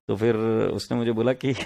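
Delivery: normal talking voice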